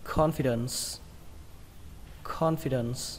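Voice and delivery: CONFIDENCE closed on a clear S; final S. Shown as a demonstration. Teacher repeating the word, final S. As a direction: finish "confidence" on S. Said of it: "kết thúc bằng âm sờ nhé".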